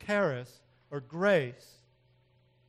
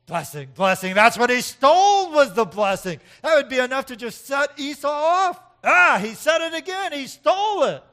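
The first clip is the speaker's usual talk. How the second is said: outraged shout; 10 semitones higher, 16 dB louder than usual. Retold or imitated. Voicing Esau's outrage.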